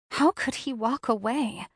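plain reading voice

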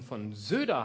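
usual speaking voice

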